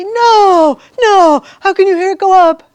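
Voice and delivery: loud, high disbelieving protest; mocking students' outraged disbelief; incredulous students. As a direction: imitate students who can't believe it, loud and high. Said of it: "they look at me and they say".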